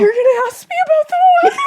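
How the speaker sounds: high, fast hammed-up shriek; very high, rapid, incredulous yell; loud mock outrage. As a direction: shriek it in exaggerated disbelief. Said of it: "this is a loud environment".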